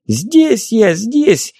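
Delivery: high squeal, calling out; squealing fairy. A narrator's reading. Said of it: "заверещала фея".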